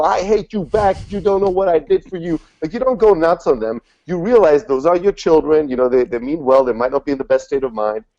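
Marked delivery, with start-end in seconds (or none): 0.0-2.4 higher, hurt mock shouting back at a child; a hammed-up parent losing it with his kid; mock parent yelling back. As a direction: play the petty parent snapping back.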